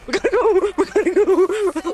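high, stretched-out urging through laughter; urgent, laughing. Real, not acted. Player urging the game couple onward, laughing urgency.

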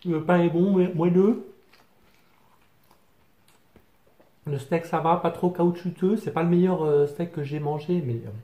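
speaking between bites; chewing while talking. Real, not acted. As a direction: mouth partly full of food. Seen 0: Holds bitten burger; mouth working, cheeks full, bites before and after.

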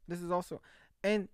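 usual speaking voice

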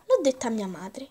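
ordinary talk, nothing marked

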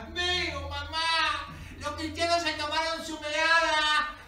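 high, full-volume cries of betrayal; outraged yelling. Acted. Tricked child and tribe condemning captives, outraged yelling. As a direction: yell it like a cheated child raising the tribe. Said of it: "Escupe y grita".

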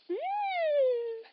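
squeaky mmm of delight; a high hum of yum; high yummy mm. Pretend. Yummy food reaction.

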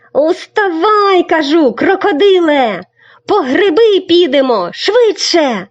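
high loud hurrying call; exclaiming boy. Acted. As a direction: rouse a sleepy friend, loud and high. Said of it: "вигукнув Сашко Циган". (високий гучний вигук)

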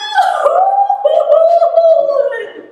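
high, loud mocking laugh; court laughing. Courtiers laugh at Yo behind the king's back, mocking laugh. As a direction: burst out laughing at Yo. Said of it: "and then everyone laughed".

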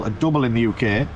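ordinary talk, nothing marked

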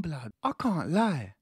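normal talking voice

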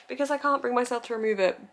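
ordinary talk

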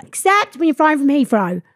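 ordinary delivery

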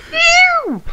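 very high, loud squeaky meow; squealing cat meow. Pretend. Cat noise taunt.

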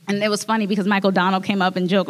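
usual speaking voice

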